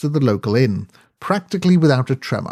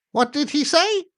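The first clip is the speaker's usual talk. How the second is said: put-on high, quavery old lady voice; a much higher elderly aunt voice; 14 semitones higher than usual. A narrator's reading. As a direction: ask like a deaf elderly aunt.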